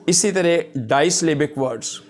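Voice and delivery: ordinary talk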